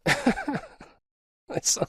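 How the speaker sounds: laughing. Real, not acted. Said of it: "sound like I'm getting mad at you".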